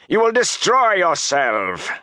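slow, high, furious roar. Acted. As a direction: roar the warning. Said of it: "Baalzamon roared".

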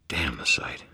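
breathy whispered oath under the breath; whispered curse. Acted. Wizard cursing his sight in a whisper. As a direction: mutter it to yourself, voiceless.

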